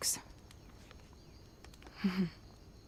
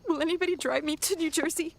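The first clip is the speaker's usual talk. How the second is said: higher, fast and urgent plea; anxious urgency; 8 semitones higher, 5 dB louder, faster than usual. Acted. Wife begging the room for a ride to New Jersey, urgent. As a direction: rush it out, suddenly desperate.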